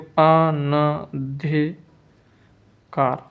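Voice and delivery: अनधिकार slowly as अ-न-धि-कार; syllable-by-syllable dictation. Shown as a demonstration. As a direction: pause between the syllables of अनधिकार. Teacher dictates spelling syllable by syllable.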